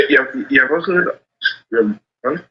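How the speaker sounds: normal talking voice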